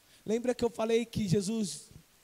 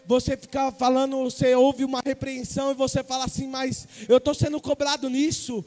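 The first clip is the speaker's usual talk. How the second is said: loud, raised-pitch preaching building to a shout; preaching at full force; 4 semitones higher, 11 dB louder than usual. Acted. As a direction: drive each repeated phrase harder. (pregação inflamada, quase gritando)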